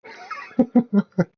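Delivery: high, loud cackling laugh; loud high-pitched laugh; burst of laughter. Real, not acted. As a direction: crack up at your own joke. Laughs at own dark joke.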